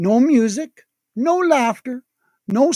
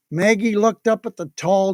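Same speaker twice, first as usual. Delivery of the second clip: ordinary delivery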